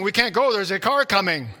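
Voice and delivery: re-enacting shouting in the car at night; retold yelling. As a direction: call out to the car in a panic. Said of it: "and I'm yelling".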